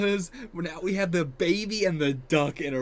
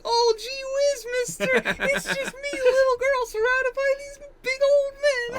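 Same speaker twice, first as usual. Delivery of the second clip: put-on high, slow little-girl voice; naive little girl voice; 5 dB louder than usual. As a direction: play it sweet and wide-eyed like a naive girl. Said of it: "her just being like".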